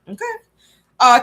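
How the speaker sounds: ordinary talk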